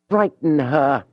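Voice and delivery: Julien bursts out over the book; sudden shout. Acted. Julien alone in his room, suddenly shouting the thought. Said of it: "he shouted all of a sudden".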